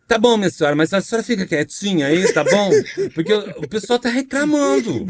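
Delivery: high, flamboyant stage character voice. Acted. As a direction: scold her sweetly in a camp voice.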